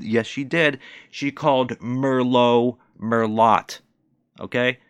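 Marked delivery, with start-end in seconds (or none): 1.1-3.8 "Merlot Merlot", slow, second as "mer-LOT" with t; mock mispronunciation. Ranting to viewers, slow sounded-out "Merlot". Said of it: "sounded it out like somebody who's never said".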